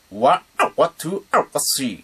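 "わ、あわ、トゥ、あわ、スリー" scratched from the throat. Shown as a demonstration. Teacher scratches the practice phrase for beginners. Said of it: "これを実際にボイスクでやってみると".

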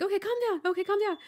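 high-pitched flustered reassurance; retold urgent calming. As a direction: act out anxious calming of a sister. Retold calming of crying sister.

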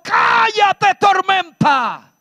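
high, loud shout of command; Jesus shouting down the storm. Acted. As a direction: rebuke the storm with full authority. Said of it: "Jesús dijo".

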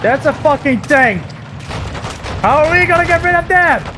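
high-pitched alarmed outcry; exasperated, high gamer panic; alarmed exclamation. Real, not acted. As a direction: raise the pitch in dismay at the threat. Alarmed at tank in game.

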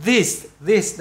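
"this" said slowly with the same TH as "they"; slow, separated "this, this"; TH example. Shown as a demonstration. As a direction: model the opening TH of "this", twice. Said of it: "Nó giống như".

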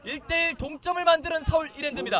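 high, loud goal call; excited goal call. Acted.

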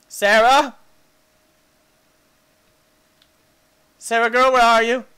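loud calling; loud searching call. Real, not acted. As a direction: call across the field for her. Calling out for pet.

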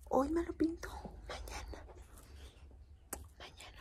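voiceless whisper, half to herself; whispered aside. Real, not acted. Telling viewers she'll dye hair tomorrow, whispered. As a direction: murmur it like a private joke.

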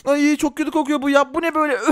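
ordinary delivery

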